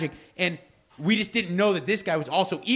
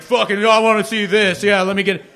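higher, louder mock eagerness; eager viewer; 5 semitones higher, 8 dB louder than usual. True-crime fan eager for a murder show, mock eager. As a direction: ham up a true-crime fan who can't wait. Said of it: "you're like".

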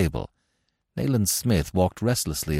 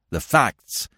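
higher, irritable demand; 4 semitones higher than usual. A narrator's reading. Investigator cutting short the warder's excuses, demanding. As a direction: demand it impatiently. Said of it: "he demanded".

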